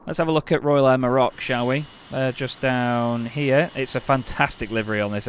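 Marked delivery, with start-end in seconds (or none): none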